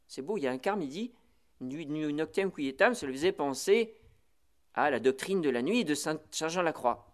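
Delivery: ordinary delivery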